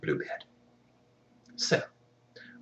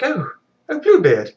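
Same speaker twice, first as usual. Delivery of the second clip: much higher, flustered mock-sweet wife; a wife caught out, higher and gushing; fake-innocent wife; 12 semitones higher, 5 dB louder, slower than usual. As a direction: squeak out a guilty cover-up greeting. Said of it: "she runs up and is like".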